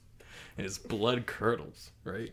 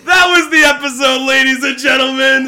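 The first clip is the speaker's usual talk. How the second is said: loud, high sign-off through laughter; real laughter, loud show sign-off; laughing shout; 19 dB louder than usual. Real, not acted. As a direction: shout the outro while still cracking up. Signing off while laughing.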